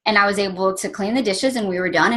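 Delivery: ordinary delivery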